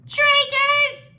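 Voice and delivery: extremely high, loud squeal; yelling gecko. A narrator's reading. Gecko yells at the monks, high shriek. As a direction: screech it as a tiny furious creature. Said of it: "the gecko yelled".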